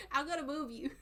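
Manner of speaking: threats broken up by giggles; laughing mock scolding. Real, not acted. Streamer threatening to move a misbehaving chat, laughing scolding. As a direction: scold while trying not to crack up.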